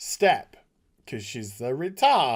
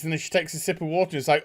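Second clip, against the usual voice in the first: ordinary delivery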